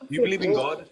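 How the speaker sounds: pointed demand. Real, not acted. Host pressing a caller for a yes or no, sharply. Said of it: "Just answer".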